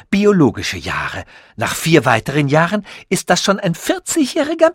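lightly voiced, pleased with his own genius; proud scientist voice. Sasul boasts to Tichy, lightly voiced proud inventor.

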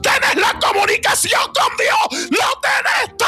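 high, crying shout of faith; tearful shout. Real, not acted. Preacher crying out to a despairing listener, tearful shout.

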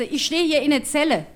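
a granny calling down the phone; shouting grandma. Retold or imitated. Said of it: "schreit sie".

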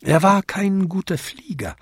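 usual speaking voice

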